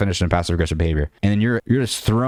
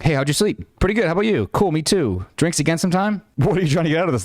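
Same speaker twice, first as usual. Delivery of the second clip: texts read out in a higher, lilting voice; play-acted chat in a raised voice; acted text exchange; 9 semitones higher than usual. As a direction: act out the messages, pitched well up. Reading out a texting exchange.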